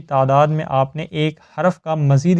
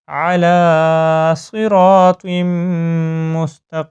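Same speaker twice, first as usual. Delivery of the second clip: slow chanted recitation, raised and louder; Quran recitation; 4 dB louder, much slower than usual. Shown as a demonstration. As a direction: recite with full tajweed. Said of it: "دوبارہ سے revise کریں". (بلند آواز میں تجوید کے ساتھ تلاوت)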